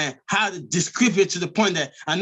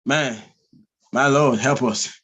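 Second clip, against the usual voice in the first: ordinary talk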